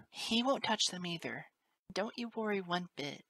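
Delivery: high, soothing motherly voice; tender reassurance. A narrator's reading. Teacher comforting sobbing colt, tender reassurance. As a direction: soothe the frightened child.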